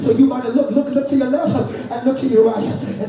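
full-force sermon delivery, driving phrase by phrase; preaching. Real, not acted. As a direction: preach hard to the congregation, punching each phrase.

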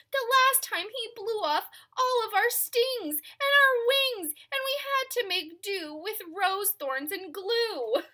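high, loud, pleading bees; buzzy bee voices. Acted. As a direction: whine it like desperate bees. Bees complain to elephant.

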